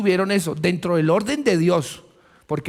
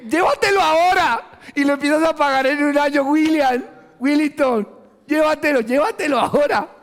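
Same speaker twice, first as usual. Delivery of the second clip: pushy sales pitch; 9 semitones higher, 4 dB louder than usual. Retold or imitated. Pushy salesperson urging purchase.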